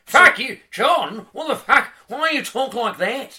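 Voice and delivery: put-on high, loud yell in Australian accent; mock-outraged heckling. Homeland folk berating him for his broad Australian accent, outraged shouting. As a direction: bawl it like an offended countryman. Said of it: "described in my homeland as cartoonishly broad".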